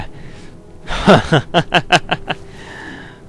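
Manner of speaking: breathy burst of laughter. Real, not acted. Laughing at own impression.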